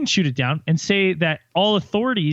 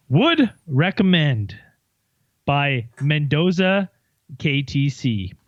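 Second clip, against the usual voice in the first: slow review read; much slower than usual. Acted. Reading a review aloud slowly.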